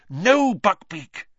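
high, loud, drawn-out protest; urgent high cry; alarmed command. Acted. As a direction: call out to stop an animal. Hermione restrains straining Buckbeak.

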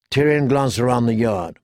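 plain reading voice

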